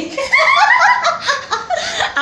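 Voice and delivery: high, loud giggling fit; burst of laughter. Real, not acted. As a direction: crack up at the mimicry. Laughs at own mimicry. (খিলখিল করে জোরে হাসি)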